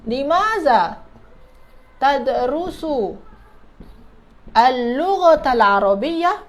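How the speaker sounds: slow, stretched words with pauses between; piece-by-piece modelling. Shown as a demonstration. Teacher building the Arabic question for students, slow word by word.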